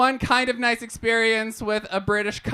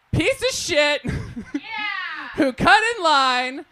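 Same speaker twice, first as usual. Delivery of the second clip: ordinary delivery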